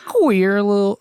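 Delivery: a lightly voiced goofy cartoon dog. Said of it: "no, that's Goofy".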